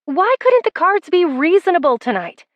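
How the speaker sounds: high-pitched frustrated plea; exasperated lament. A narrator's reading. She rails at her losing cards, high exasperated plea.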